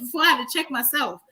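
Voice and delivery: ordinary talk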